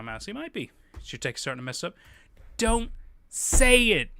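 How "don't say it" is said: a half-joking loud plea not to jinx it; long, pleading "Don't say it," pitched up; drawn-out loud plea. Real, not acted. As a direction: stretch it out slowly, louder and higher. Begging chat not to jinx.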